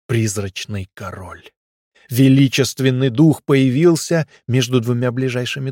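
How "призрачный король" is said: ordinary delivery, nothing marked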